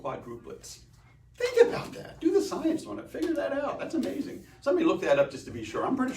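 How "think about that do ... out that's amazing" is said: much higher, laughing wonder; amazed at the armadillo fact, high and laughing. Real, not acted. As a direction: squeal it with delight.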